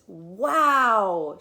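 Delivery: loud, delighted "wow"; a proud mom bowled over by the drawings; amazed mother. Acted. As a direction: marvel at the child's pictures.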